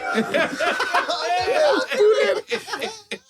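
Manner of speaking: long high-pitched laugh; high laughing fit. Real, not acted. Recalling footballer smoking.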